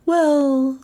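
high, hesitant character voice; Melissa's voice. A narrator's reading. Melissa stalling the caller, high voice.

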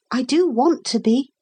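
put-on high-pitched small boy's voice; earnest boy. A narrator's reading. Boy answering the captain's praise, earnest.

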